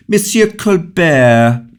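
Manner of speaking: king snapping the name, high and loud; a monarch out of patience, summoning his minister sharply. A narrator's reading. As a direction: call him out sharply and slowly. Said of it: "said the king very sharply".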